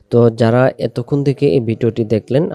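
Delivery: normal talking voice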